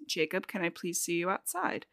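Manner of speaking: low, hushed and calm; quiet, controlled request to step outside. Retold or imitated. Edward calmly calls Jacob outside, quiet and controlled. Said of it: "very calmly".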